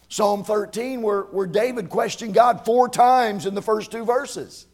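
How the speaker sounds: loud, rhythmic preacher cadence; fiery preaching. Real, not acted. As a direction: preach it with rising fire. Preaching at full force.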